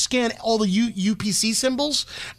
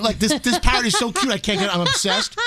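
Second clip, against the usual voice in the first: usual speaking voice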